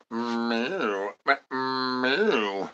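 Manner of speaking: soft, drawn-out kitty meows; a purring cat's soft meow. Pretend. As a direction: mew quietly like a little cat. Cat noises between chant lines.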